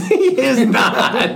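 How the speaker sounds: high laughing denial; laughing protest. Real, not acted. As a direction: laugh it off while objecting.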